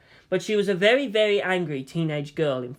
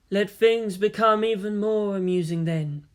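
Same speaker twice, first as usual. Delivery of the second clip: alien inner voice; 5 semitones higher than usual. Acted. The Eldar replies.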